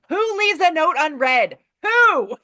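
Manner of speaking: loud, high mock outrage; hammed-up distressed outburst. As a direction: cry out in exasperated disbelief, building to the last word. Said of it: "he's very distressed".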